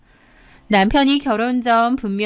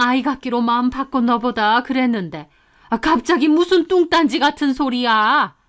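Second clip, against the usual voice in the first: put-on scolding, indignant older relative's voice; irritated rebuke, pitch rising at the end; angry mother-in-law. A narrator's reading. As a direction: tell off your daughter-in-law in annoyance. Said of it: "버럭 소리를 지르시는 겁니다".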